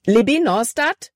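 panicked call. A narrator's reading. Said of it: "Calebs Stimme war panikerfüllt".

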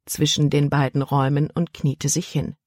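usual speaking voice

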